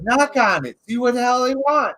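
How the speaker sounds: hammed-up friend urging you on; egging friends. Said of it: "They're like".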